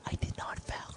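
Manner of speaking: slow whisper of I did not foul you. Retold or imitated. Iguodala mouthing to Carmelo that he didn't foul him, whispered. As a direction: breathe it silently, like lips read on TV. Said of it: "You can hear his lips".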